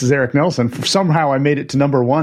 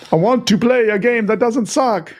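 put-on a vampire accent, a bit higher; Dracula vampire accent; 8 semitones higher than usual. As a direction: play the Count with a laugh. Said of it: "With my Dracula".

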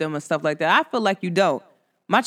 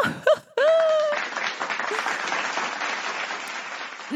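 high airless laugh; a long teary laugh, all breath; teary breathless laugh; 5 dB quieter than usual. Real, not acted. Aunt overcome, laughing breathlessly. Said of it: "making me cry now".

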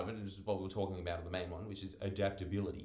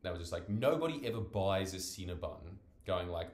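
normal talking voice

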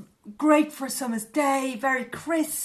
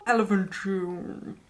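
slow, lower slowed down voice; 4 semitones lower, slower than usual. Pretend. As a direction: drag it out like slowed tape. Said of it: "Was that your slowed down voice?".

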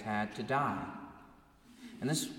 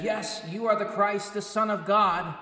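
higher and louder, glad affirmation; devoted confession; 8 semitones higher, 6 dB louder than usual. Retold or imitated. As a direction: proclaim it with eager devotion. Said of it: "who so dutifully and lovingly says".

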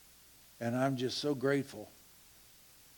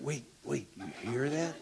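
hushed giggle; 3 semitones lower, 6 dB quieter than usual. Retold or imitated. Pestering friends in silence.

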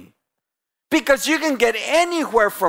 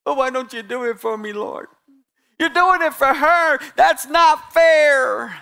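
whiny voice; 6 semitones higher than usual. Retold or imitated. Preacher mocking a whiny prayer to God, hammed-up whine. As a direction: whine it like a sulking child. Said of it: "You know what whine is?".

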